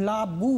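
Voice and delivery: LAA-BU, the final u fully sounded; full final vowel. Shown as a demonstration. Teacher showing pupils the full vowel, fully pronounced. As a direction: say লাবু as two full syllables, la-bu. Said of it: "পূর্ণাঙ্গ রূপে উচ্চারিত হচ্ছে".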